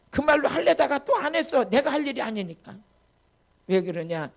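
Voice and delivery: normal talking voice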